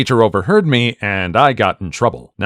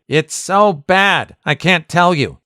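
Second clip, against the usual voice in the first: put-on kid voice; 6 semitones higher, slower than usual.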